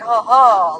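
loud mock Gru accent laugh. Showing partner the Gru accent, loud mock laugh. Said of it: "it sounds like Gru".

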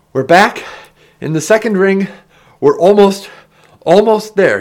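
high, loud shouts in bursts; shouting. A narrator's reading. Ben updates Heron as they fall, shouting. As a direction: shout progress over rushing air. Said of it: "he shouted".

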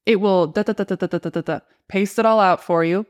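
normal talking voice

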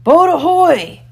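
long, high, loud captain's hail; loud drawn-out pirate call; ringing call. A narrator's reading. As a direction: sing out across the lagoon. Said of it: "another voice rang over the lagoon".

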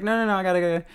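high, hurried no no no; flustered hurry. Retold or imitated. Tim hurrying out of the room, high and flustered. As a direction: rush out the door nervously.

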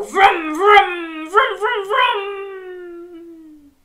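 high, loud engine revs; pretend car noises. Storyteller plays car with the children, revving vrooms. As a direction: rev repeatedly, stretching the last one. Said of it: "pretend you're back in your car and go".

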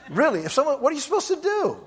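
high-pitched, incredulous question; mock bafflement. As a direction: voice shoots up, baffled and amused. Mock bafflement at being told "rebuke.

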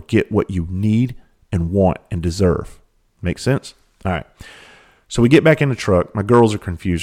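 usual speaking voice

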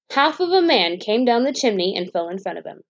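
usual speaking voice

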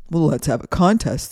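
proud, blustering wind voice; boastful wind. Acted. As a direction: play the bragging wind. Wind challenges the sun.

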